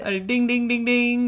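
high bell-ringer chant; town crier. Acted. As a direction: ring it out like a crier. Mock bell ringing.

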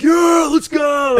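high, slow hyped yell; mosh pit shout. Retold or imitated. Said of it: "we're all just like".

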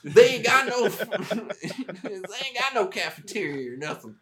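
sergeant major. Retold or imitated. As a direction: ham up the old Southern sergeant. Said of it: "he was like".

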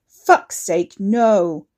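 loud furious snarl; angry growl. Acted. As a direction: bark it, cutting her off. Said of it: "he growled at her".

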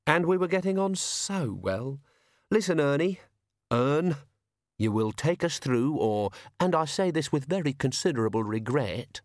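polite menacing toff. Acted. Young toff threatening a coachman with calm politeness.

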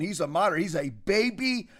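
usual speaking voice

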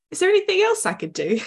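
put-on British accent. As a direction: offer help in a mock British accent.

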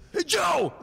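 sudden high, loud holler; abrupt loud shout. Retold or imitated. Photographer startling Joe into looking up, loud shout. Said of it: "I did it very loudly and abruptly".

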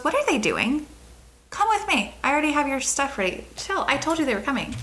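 high, incredulous scolding of staff; hotel owner intervening. Retold or imitated. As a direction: imitate a boss waving the clerk off. Voices owner overruling the hotel staff.

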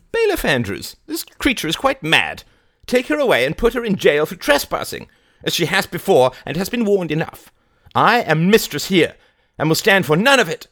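very high, loud indignant commands; imperious old lady. A narrator's reading. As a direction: rage at the bailiff as mistress.

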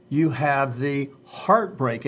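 heartbreak drawn out slowly and weighted; stress on heartbreak. Retold or imitated. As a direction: lean hard on heartbreak, slow and grave. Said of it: "he emphasized the word heartbreak".

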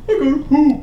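high, loud little announcement with laughter behind it; a lightly put-on, high-pitched plea; mock announcement. Passenger telling the impatient group he needs a toilet, mock urgent.

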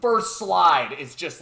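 ordinary talk, nothing marked

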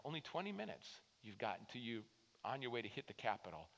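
usual speaking voice

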